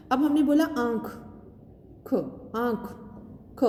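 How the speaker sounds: आँख split so the final ख is heard; sounding out the letter. Shown as a demonstration. Teacher sounds out ख to her class. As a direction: say आँख, then ख on its own to show the अ sound. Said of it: "बोल कर देखिए".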